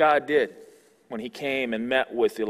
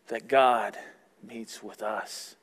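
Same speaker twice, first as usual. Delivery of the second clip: ordinary delivery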